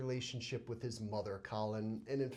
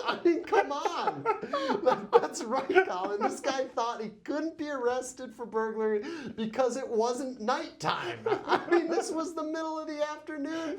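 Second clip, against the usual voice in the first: high, loud laughing disbelief; 10 semitones higher, 4 dB louder than usual. Real, not acted. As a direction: laugh through the words in disbelief. Laughing at dumb criminal.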